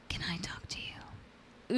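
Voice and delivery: drawn-out eerie whisper; slow creepy whisper. Shown as a demonstration. Showing viewers how the stranger sounded, slow creepy whisper. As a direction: whisper it slowly to send chills. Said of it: "just whisper that sentence out loud".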